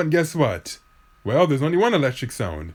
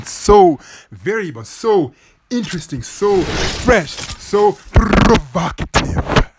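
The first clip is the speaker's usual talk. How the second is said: building, higher hammed-up praise with hard plosives; spitty over-emphatic delivery; 5 semitones higher, much slower than usual. Real, not acted. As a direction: punch each adjective, ending on a slow rolled r. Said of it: "When you talk like that".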